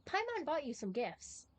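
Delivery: ordinary talk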